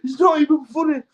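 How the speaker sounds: mock high-pitched disbelief; high squeaky self-quote. Laughing at himself for no reason, high baffled squeal. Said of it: "And I'm like".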